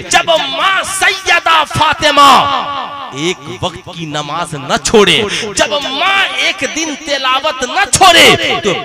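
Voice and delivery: high, loud preaching, shouted out; fiery preaching. Acted. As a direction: thunder the sermon at the faithful. Preaching at full force.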